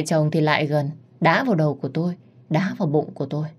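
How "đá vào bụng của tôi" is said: ordinary delivery, nothing marked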